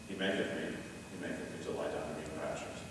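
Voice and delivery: softly, "maketh" repeated gently; quiet read. Shown as a demonstration. Conductor reading the repeated text to the choir, softly. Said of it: "That's what he's just done".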